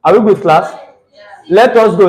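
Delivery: usual speaking voice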